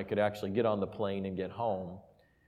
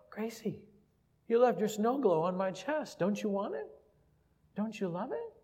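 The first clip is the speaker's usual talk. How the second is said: higher, teasing father's questions; playful daddy voice; 11 semitones higher than usual. Retold or imitated. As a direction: tease her gently, up high. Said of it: "And I go".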